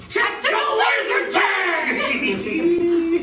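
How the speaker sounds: high, slow, laughing shout of delight; excited shout. Acted. Thrilled guest hearing about tactical laser tag, laughing shout. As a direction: savor each word with glee.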